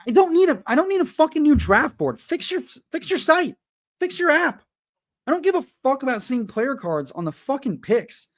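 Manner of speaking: high, ranting and furious at the site; real fed-up rant at a broken app; angry rant. Real, not acted. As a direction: blow up at the developers. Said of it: "rant over".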